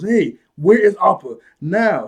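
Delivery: slow, loud, high mock demand; hyped-up demand. Aang wrecking ships to find Appa, loud mock anger. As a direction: hammer out the question, imitating fury. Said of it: "he gets hyped up".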